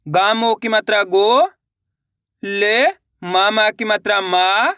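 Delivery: slow spelling-out with long rising गो and मा; syllable-by-syllable reading. Shown as a demonstration. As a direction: stretch each syllable, pause between them. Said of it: "एक बार फिर से देखिए".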